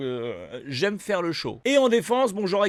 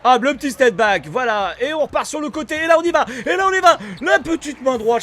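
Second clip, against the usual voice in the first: loud, high, building hype; excited play calling; 7 semitones higher, 9 dB louder than usual. Real, not acted. Player narrating his own attack live, excited shouting. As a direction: drive the play along with your voice. Commente son attaque en direct.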